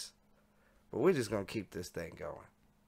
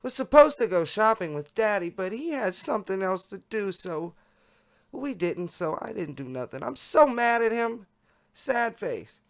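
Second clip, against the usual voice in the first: higher-pitched voice for a chat participant; high chat-log voice; 11 semitones higher, 6 dB louder than usual. Acted. Reader voices a message about cancelled shopping, high voice. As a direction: read the messages in a raised pitch.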